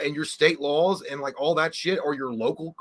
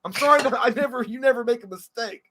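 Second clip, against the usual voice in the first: hammed-up indignation; 9 semitones higher, 7 dB louder than usual. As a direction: play the wronged partner. Mock lovers' quarrel.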